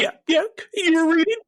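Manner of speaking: very high, squeaky comedian impression; Ricky Gervais. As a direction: squeal the question like a giggly British comic. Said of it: "Then he had my Ricky Gervais".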